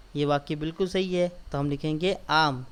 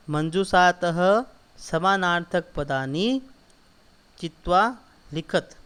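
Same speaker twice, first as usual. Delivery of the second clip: recitation; 3 dB louder, slower than usual. Shown as a demonstration. Teacher reading the question aloud.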